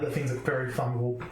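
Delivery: ordinary talk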